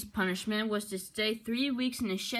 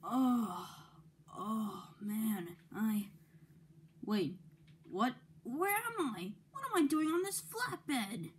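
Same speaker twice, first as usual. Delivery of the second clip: dazed waking up; 3 semitones higher, 6 dB quieter, slower than usual. Acted. Thomas waking up confused.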